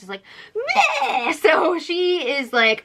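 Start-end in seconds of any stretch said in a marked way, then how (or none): 0.6-1.3 high imitated bleat; mock "meh" squawk. Mimics Paris with a noise.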